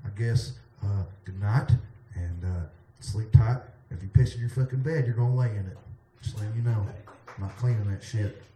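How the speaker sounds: quiet. Acted. Drowsy bedtime sign-off.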